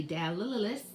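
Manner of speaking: "Dallas" as dal-lil-lil-lis; wrong doubled sound. Shown as a demonstration. Teacher showing how not to read a name, l sound repeated. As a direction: stutter the l in "Dallas" into extra syllables. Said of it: "We don't say".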